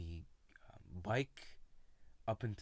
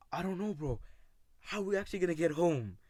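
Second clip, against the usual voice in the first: higher and louder, fast and frantic; panicked friend; 9 semitones higher, 7 dB louder, faster than usual. Retold or imitated. Lost boy panicking to his friend about getting home, frantic. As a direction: blurt it out in a panic.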